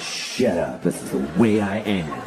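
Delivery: low, brooding tough guy; Matt Damon. Acted. Actor's hero answering his friends, brooding. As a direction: shrug them off in a low voice. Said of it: "he's like".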